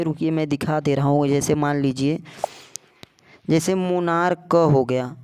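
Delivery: ordinary talk, nothing marked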